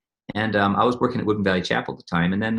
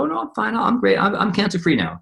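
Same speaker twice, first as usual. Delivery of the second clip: breezy dismissal; 10 semitones higher than usual. Retold or imitated. Sandy brushing off advice.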